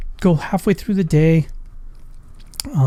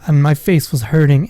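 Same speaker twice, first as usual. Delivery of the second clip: ordinary talk, nothing marked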